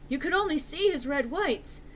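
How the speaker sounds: ordinary delivery, nothing marked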